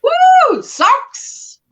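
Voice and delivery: excited. Real, not acted. Delighted at the socks.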